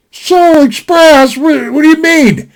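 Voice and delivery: slow, puzzled echo of the phrase; baffled question. Retold or imitated. Cousin echoing the phrase, slow and baffled. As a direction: repeat it back slowly, confused.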